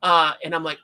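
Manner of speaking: ordinary talk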